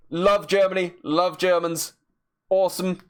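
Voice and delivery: ordinary talk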